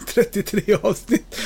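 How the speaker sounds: laughing through the words, slow and high; real laugh breaking into the words. Real, not acted. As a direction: chuckle at your own blunder. Laughs at own mistake.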